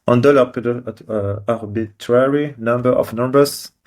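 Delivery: slow, careful mock English accent. French vlogger showing off his English accent. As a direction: lean into an English accent, slowly. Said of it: "Comment vous trouvez mon accent anglais?".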